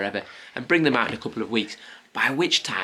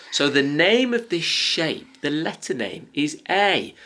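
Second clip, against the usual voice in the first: slow, raised, sing-song teaching voice; talking to a toddler; 6 semitones higher, 5 dB louder, slower than usual. Acted. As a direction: draw out the letter name for a small child. Parent teaching letter names to toddlers.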